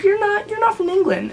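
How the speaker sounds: imitating the man who followed her, high mock voice; mocking the stranger. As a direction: caricature the pushy guy up high. Mimicking the pushy stranger.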